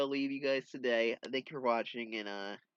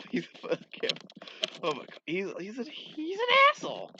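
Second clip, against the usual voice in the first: helpless laughter breaking into a high squeal; laughing rant; 11 semitones higher, 7 dB louder than usual. Real, not acted. Comic reader ranting about a hated character, laughing uncontrollably. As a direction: crack up mid-insult, climbing high and loud.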